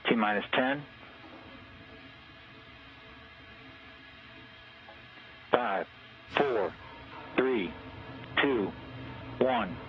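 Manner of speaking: countdown crackling over a radio loop; mission control radio. Real, not acted. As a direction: call it like a launch controller on comms. Launch countdown over comm loop.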